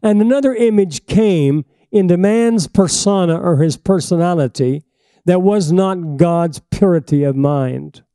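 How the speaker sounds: fiery pulpit preaching, slow and emphatic. Acted. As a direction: preach it hard to the congregation. Preaching at full force.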